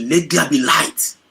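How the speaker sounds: loud, high, slow proclamation of the creator; voice of God. Acted. God speaking the world into being, booming and slow. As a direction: boom it out slowly like a divine command. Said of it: "But say".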